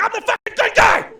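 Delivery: high, loud exasperated yell; comic yelling back at a heckler. Retold or imitated. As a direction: scream your innocence at them. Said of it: "Now I'm yelling at him".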